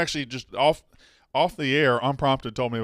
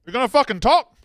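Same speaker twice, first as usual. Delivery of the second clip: yelling; 9 semitones higher, 5 dB louder than usual. Real, not acted. Host yells at silent caller.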